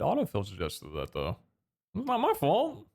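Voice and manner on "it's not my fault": high defensive protest; defensive whine. Real, not acted. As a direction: whine that it isn't your fault. Defending after delta mistake.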